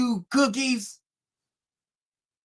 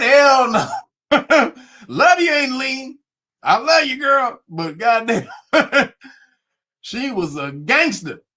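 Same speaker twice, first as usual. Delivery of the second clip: normal talking voice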